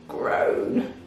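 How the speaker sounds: whispered breathy groan; a hushed groan of pain. Acted. Crocodile in pain in the forest, soft groan. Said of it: "a loud groaning sound".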